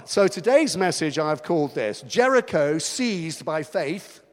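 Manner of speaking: ordinary talk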